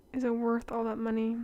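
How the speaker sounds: usual speaking voice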